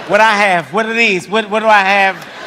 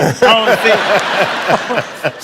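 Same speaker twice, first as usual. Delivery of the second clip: drawn-out, laughing pleading; slow laughing plea; slower than usual. Retold or imitated. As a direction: stretch it out, giggling. Repeats refusal, slower.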